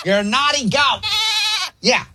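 high, playful voice scolding a naughty pet; scolding a goat. Acted. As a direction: talk to the goat like a naughty dog. Prankster scolding imaginary goat.

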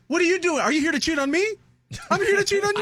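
put-on a caught girlfriend snapping back; mock girlfriend. Voicing a girlfriend catching him.

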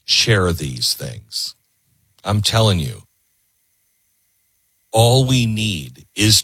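slow, low and earnest; earnest appeal. Real, not acted. Host urging viewers to share, slow and earnest.

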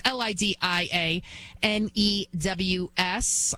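spelling it letter by letter, slowly; spelled out. Shown as a demonstration. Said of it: "at Lydia News".

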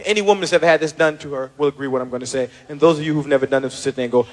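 ordinary talk, nothing marked

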